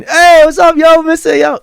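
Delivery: very high, loud hollered hello; over-the-top cheery shout; put-on hearty greeting. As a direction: call out a hyped-up greeting to the whole room. Acting out a cheerful entrance greeting.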